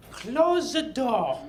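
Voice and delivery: stern French Madame order; French accent. Retold or imitated. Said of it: "she spoke like this".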